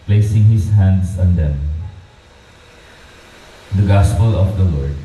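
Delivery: steady, measured proclamation at the lectern; Gospel reading. Acted. Priest reads the Gospel to the baptism family, proclaiming. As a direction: read it out formally for the whole church.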